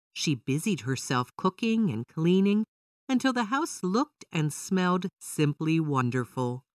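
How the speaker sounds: plain reading voice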